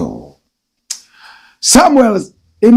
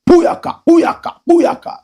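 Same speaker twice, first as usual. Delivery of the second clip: high, rapid "puyaka" like gunshots; mock bullet sound; 13 semitones higher than usual. Host mimics bullets before the big story. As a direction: fire off "puyaka" like a burst of bullets. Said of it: "like a bullet from Otagon".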